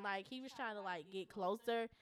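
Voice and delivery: ordinary talk, nothing marked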